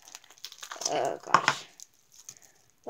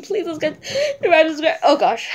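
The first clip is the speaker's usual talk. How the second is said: louder, higher, laughing plea; teary laugh; 4 semitones higher, 10 dB louder than usual. Real, not acted. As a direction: beg with a giggle through tears. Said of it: "I'm about to cry".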